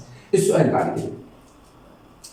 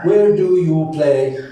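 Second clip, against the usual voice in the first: slow, drawn-out question for learners; teacher's slow demonstration; slow modelling; 3 dB louder, much slower than usual. Shown as a demonstration. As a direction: model each word slowly.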